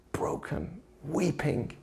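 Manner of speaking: put-on breathy whisper of a broken boy. A narrator's reading. Telling of the boy walking home, whispered. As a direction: let the word fall to a whisper.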